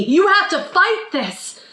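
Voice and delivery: high-pitched, urgent dramatic exhortation; pleading film hero. Acted. Hero to possessed friend in a film, urgent high plea. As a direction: beg them to fight, pitch leaping on key words. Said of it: "our hero wrestles them to the ground".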